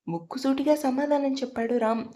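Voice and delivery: ordinary delivery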